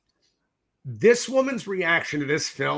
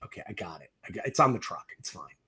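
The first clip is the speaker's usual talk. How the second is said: low-key quote; 8 semitones lower, 9 dB quieter, faster than usual. Retold or imitated. Effects guy casually agreeing to pay.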